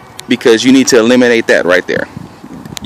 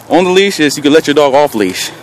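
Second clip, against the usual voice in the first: normal talking voice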